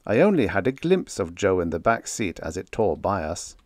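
ordinary delivery

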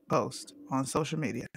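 usual speaking voice

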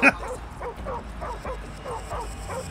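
long, high, soft laugh. Real, not acted. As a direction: let a quiet giggle run on. Laughs at the slow scene.

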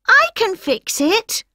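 put-on high, eager child; eager kid voice. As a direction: cheerfully offer to help. Character Star voiced.

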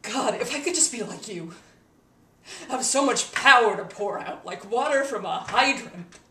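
loud, impassioned longing; envious outburst. Acted. Wishing for Harry's power, loud envious outburst. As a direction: burst out with envy, leaning hard on the words.

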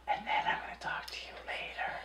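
quick, very soft whisper; mock-intimate whispered aside; husky whisper. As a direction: drop suddenly into a hushed whisper. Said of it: "Husky whisper".

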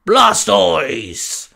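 drawn-out creature roar of its name; Blastoise cheerful cry. Acted. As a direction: bellow happily as a big Pokemon, pitch falling away. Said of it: "Blastoise said with a grin".

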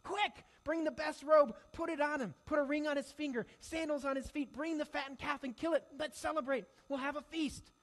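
loud, high, overjoyed commands; joyful orders. Retold or imitated. Father ordering servants to prepare the feast, loud and joyful. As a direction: call out to the servants in delight.

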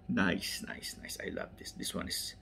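very soft, whispery murmur; barely voiced appreciative aside; hushed admiration. Real, not acted. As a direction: murmur approval to yourself. Admiring the car to self.

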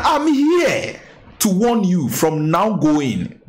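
high, drawn-out hammed-up preacher warning; mock pastor warning. Imitating the pastor warning critics off his name, hammed-up and high. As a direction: ham up the pastor's stern warning, slowly.

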